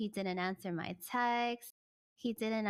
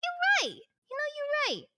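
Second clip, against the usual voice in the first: much higher, louder mock epiphany; dramatic realization; 4 dB louder than usual. Daughter finally agreeing with mom, high dramatic realization. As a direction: repeat it growing into a big revelation. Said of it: "And I was just like".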